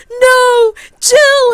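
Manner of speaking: very high, loud drawn-out cry; dramatic mock despair. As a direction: scream it like a melodramatic hero. Deadpool cries out for fallen Jill.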